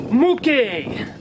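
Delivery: high, ringing product reveal; grand announcement. Acted. Vlogger unveiling his new microphone, grand announcement. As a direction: announce it like the big prize.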